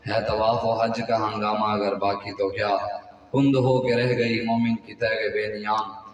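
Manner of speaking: low, soft, measured poetry recital; verse recitation. Acted. Preacher opening his sermon with Iqbal's poem, low verse recitation. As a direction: recite the couplets in a low, even voice.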